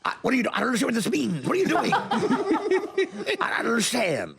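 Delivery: lightly voiced baffled comedian; Albert Brooks. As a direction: play a neurotic comic who can't follow. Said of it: "here's Albert Brooks".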